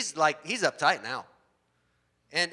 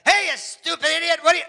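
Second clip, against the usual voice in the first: much higher and louder angry shouting; mock furious yell; 13 semitones higher, 7 dB louder than usual. Blind evangelist yelling out the window at nobody, loud furious shout. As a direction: yell at the other driver. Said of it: "And he's like".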